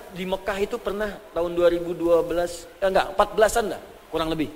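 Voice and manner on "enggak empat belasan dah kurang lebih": ordinary talk, nothing marked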